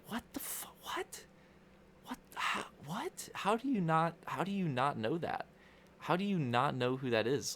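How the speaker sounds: high breathless disbelief, dropping to a near whisper; stunned disbelief. Retold or imitated. Streamer retelling his mom's question, stunned and incredulous. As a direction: gasp out the words in stunned shock. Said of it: "in my head, I'm just like".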